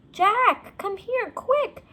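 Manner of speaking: high, loud urgent call; shouted high and loud across the hill. A narrator's reading. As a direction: call him over fast from afar. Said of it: "Annie called".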